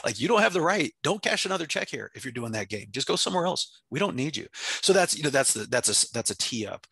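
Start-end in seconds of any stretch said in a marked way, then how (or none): 0.2-4.5 raised, firm and blunt; firm warning. Retold or imitated. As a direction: tell them flatly they don't belong. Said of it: "I'll talk directly to people like that".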